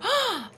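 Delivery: souffle coupé, aigu; halètement surpris. Real, not acted. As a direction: prise d'air soudaine de surprise. Zombie attaque soudain. (halètement de surprise)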